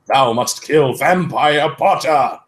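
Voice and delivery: slow, lightly hammed dark lord voice; grand villain command. A narrator's reading. Voldemort commands Ebony, mock-grand villain. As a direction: order it like a pompous villain. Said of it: "he yelled".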